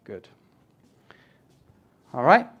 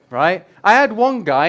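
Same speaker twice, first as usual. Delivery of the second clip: ordinary talk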